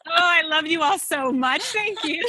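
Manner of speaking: high, loud gush breaking into laughter; delighted thanks. Real, not acted. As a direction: thank them effusively, laughing through the words. Thanks hosts after glowing introduction.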